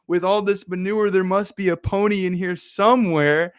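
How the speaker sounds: excited hope. Acted. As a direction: sound sure a pony is hiding in there. Optimist twin hopeful about pony.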